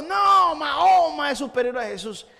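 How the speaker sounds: angry man yelling at the preacher; angry shout. Retold or imitated. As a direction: shout it back, jumping with anger. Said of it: "que brincaba de enojado".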